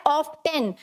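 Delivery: often said as OFF-ten, T sounded; often in two parts, off-TEN with a clear T; often with T pronounced. Shown as a demonstration. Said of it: "क्या बोलते हैं".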